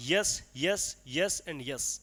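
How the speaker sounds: slow marking. Shown as a demonstration. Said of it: "marking stress in each foot".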